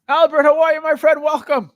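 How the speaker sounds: loud, high-pitched greeting; shouted welcome. Real, not acted. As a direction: call out a big hello to a newcomer. Calling out welcome to viewer.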